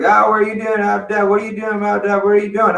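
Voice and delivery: high, nosy biker calling out; curious biker. Retold or imitated. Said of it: "He rolls up and is like".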